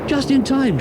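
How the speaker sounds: high, loud cry of relief; a small broom shouting with relief; shouted. Acted. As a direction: call it out high over the wind. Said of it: "shouted Broomstick".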